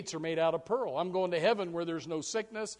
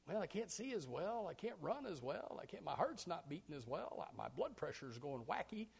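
mild grumbling; 13 dB quieter than usual. Retold or imitated. Complaining old person voiced.

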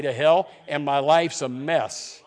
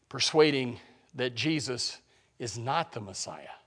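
ordinary talk

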